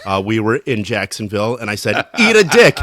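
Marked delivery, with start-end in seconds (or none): 2.2-2.8 a much higher, louder shouted insult; mock yell. Retelling shouting into a friend's voice text, loud. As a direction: blast it at the phone. Yelling at talk-to-text phone.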